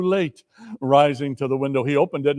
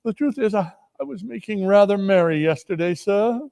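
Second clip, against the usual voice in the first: a hint of a fearful clerk; nervous Bob; 5 semitones higher than usual. A narrator's reading. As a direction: plead timidly. Bob apologising to his boss.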